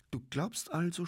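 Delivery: high-pitched mocking question; teasing, derisive lilt. A narrator's reading. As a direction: needle him with light scorn. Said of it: "spottete Sepp".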